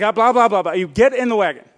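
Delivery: lightly voiced mom nagging to get in; mock nagging. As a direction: rattle off blah blah like a scolding mom. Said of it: "She's like".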